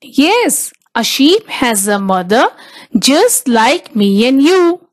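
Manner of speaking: usual speaking voice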